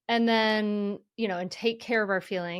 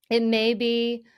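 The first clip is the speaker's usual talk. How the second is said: ordinary delivery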